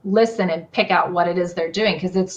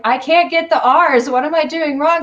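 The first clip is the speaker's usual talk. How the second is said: frustrated question; 8 semitones higher, 4 dB louder than usual. Retold or imitated. Acting out own frustrated question.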